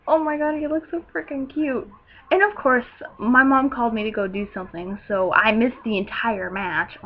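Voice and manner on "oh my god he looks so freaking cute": high-pitched excited gush; retold giddy swoon; fangirl gushing. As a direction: coo over him like a smitten fan. Retold fangirl reaction to wrestler.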